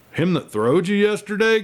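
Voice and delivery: high, teasing grin in the voice; grinning. A narrator's reading. Old cowhand teasing the doctor about the piebald, grinning and high. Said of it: "grinned old Buck Daniels".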